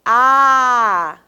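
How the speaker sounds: "A" held long and loud; sustained, wide-open vowel "A"; letter sound A. Shown as a demonstration. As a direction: draw out an open "A" for learners. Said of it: "Eu tenho aqui depois a letra".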